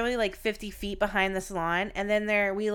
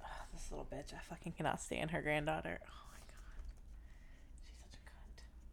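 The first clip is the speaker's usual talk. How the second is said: hushed, breathy venting whisper; 6 semitones lower, 19 dB quieter than usual. Real, not acted. Muttering insults about the boss's granddaughter, whispered. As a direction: whisper the insult so no one hears.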